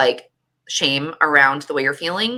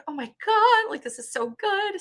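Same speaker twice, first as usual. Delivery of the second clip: much higher, breaking with happy tears; tearful joy. Retold or imitated. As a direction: squeak it out, overwhelmed and moved. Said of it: "welling up with tears".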